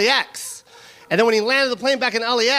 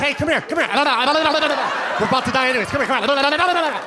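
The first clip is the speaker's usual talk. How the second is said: slow, laughing come-on; lecherous beckoning. Retold or imitated. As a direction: coax them over, leering. Beckoning women before crash.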